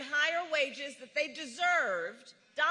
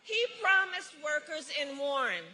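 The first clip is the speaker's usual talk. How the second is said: ordinary talk, nothing marked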